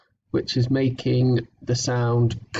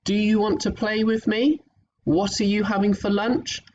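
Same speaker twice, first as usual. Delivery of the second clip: question examples; 6 semitones higher than usual. Shown as a demonstration.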